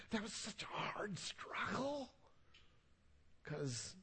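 slow, hushed whispery admission; confiding whisper. Real, not acted. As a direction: whisper it slowly to the congregation. Confides how hard apologizing was.

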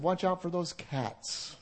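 soft, slow tongue-in-cheek swap of dogs for cats; joking aside. Shown as a demonstration. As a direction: toss it off quietly as a joke. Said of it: "I would have said".

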